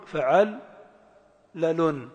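فعل rising, pause, then لل; syllable by syllable. Shown as a demonstration. Teacher putting the pattern together, فعل then لل in separate beats. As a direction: break cleanly between فعل and لل. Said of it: "قوية في التنغيم ومعرفة المقاطع الكلمات".

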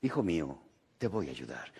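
low, soft voice from above; voice of God. Acted. God answering the man on the cliff, low and soft. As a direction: speak low and quiet like a calm God. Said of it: "se oyó una voz que dijo".

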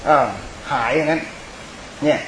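ordinary talk, nothing marked

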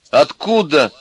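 high, loud indignant yell; heated, high-pitched outburst. A narrator's reading. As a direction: shout the demand across the room. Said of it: "заорал Костя".

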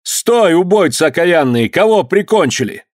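high-pitched furious challenge; full-voiced shout. A narrator's reading. Master halting fleeing killers, high angry shout. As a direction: stop them with a high, forceful yell. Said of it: "крикнул Данила во весь голос".

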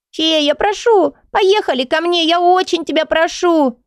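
put-on high child voice, slow pleading; pleading little girl. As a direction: beg like a small child.

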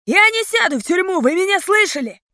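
high, agitated outburst; panicked defiance. A narrator's reading. Bandit refusing prison to the gang, agitated outburst. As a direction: protest it at high pitch.

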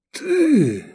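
high, strained wheeze; hoarse croak. A narrator's reading. Wounded man recognizes his comrade, hoarse gasp. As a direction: croak it out in high-pitched disbelief. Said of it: "прохрипел Лобанов".

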